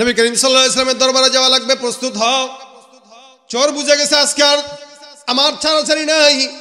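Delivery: high, loud, chanting sermon delivery; full-force preaching. Acted. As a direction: proclaim it to a big crowd. (উচ্চকণ্ঠে সুরেলা ওয়াজ)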